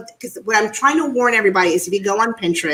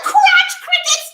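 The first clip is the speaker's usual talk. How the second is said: much higher, slow shriek of crotch crickets; retold scream; 3 dB louder, slower than usual. Blurting it out in the store, high slow shriek. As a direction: squeal it out between laughs. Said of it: "I'm screaming, I'm laughing so loud".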